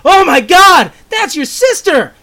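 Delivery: high, loud horrified cry; terrified exclamation. Acted. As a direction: scream it in sudden horror. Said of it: "with terror in his eyes, proclaims".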